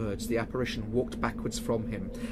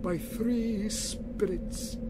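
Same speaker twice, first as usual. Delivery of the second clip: wailing ghost; 10 semitones higher, much slower than usual. Acted. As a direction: drag each word out like a wail from the grave.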